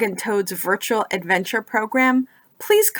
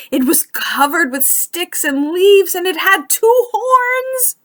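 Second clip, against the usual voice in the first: lively storybook narration, rising higher as the story heats up; reading to children; 5 semitones higher, 4 dB louder than usual. A narrator's reading. Librarian reading Frog and Toad to young listeners, animated storybook voice. Reads picture book to children.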